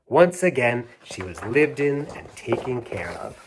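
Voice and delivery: gentle read-aloud storytelling; a storyteller reading The Little House to children; story narration. A narrator's reading. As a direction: read it like a bedtime picture book. Reads picture book aloud.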